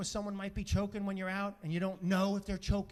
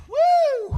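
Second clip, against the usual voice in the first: high, loud "woo" of relief; loud, high whoosh of relief; big relieved whoop; 16 dB louder than usual. Retold or imitated. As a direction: let out a long, hammed-up exhale after a close call. Takes a deep breath.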